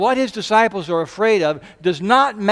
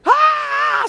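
high, loud imitated scream; mock scream; 4 dB louder than usual. Preacher imitating the disciples, high screaming yelp. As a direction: let out a short frightened scream.

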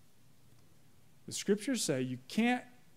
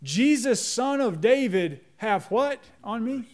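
loud pleading call, higher and louder; crying out; 5 semitones higher, 5 dB louder than usual. Retold or imitated. Blind beggar calls out to Jesus, loud pleading cry. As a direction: cry out to him for help. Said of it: "was crying out".

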